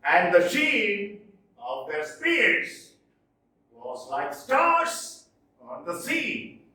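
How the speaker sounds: slow and rhythmic, sheen and spears hit loud; anapestic recitation. Shown as a demonstration. Teacher reciting Byron to the class, slow and rhythmic. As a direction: roll the line out slowly with the beat. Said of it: "How many feet?".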